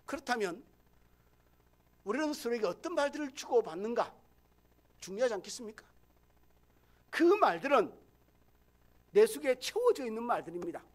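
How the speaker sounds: loud, driving pulpit delivery; full-force preaching. Acted. Pastor preaching hard to his congregation, loud and driving. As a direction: press each point on the congregation.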